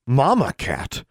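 startled, high-pitched exclamation; exclaimed question. A narrator's reading. Girl hearing there is a mama cat, exclaimed. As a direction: blurt it out in surprise. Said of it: "Alexandra exclaimed".

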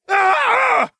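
very high, loud yelp of pain; pained cry. A narrator's reading. Ron yelps as he is set on fire, pained cry. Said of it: "the Weasley cried".

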